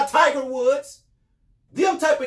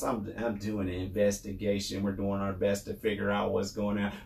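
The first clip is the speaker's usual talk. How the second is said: very low, quiet mock official; mocking imitation; 9 dB quieter than usual. Host parroting investigators' six-month excuse, mocking. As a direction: drop low and soft, parroting their excuse.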